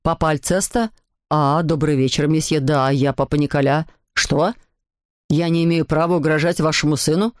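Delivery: low-pitched dad, questioning; father on phone. A narrator's reading. Papa argues with Alceste's father, low indignant voice. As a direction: answer another parent in low paternal voice.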